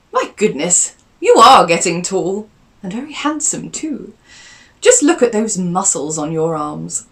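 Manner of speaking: aunt fussing over how tall he is; doting aunt. Acted.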